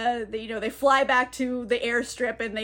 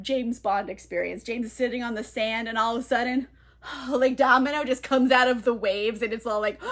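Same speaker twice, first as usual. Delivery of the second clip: ordinary talk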